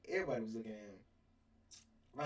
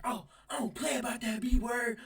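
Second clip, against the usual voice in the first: offended coworker; 10 semitones higher, 6 dB louder than usual. Retold or imitated. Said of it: "she was like".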